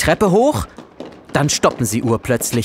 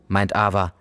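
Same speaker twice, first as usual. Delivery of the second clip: ordinary delivery, nothing marked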